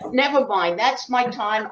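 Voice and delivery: usual speaking voice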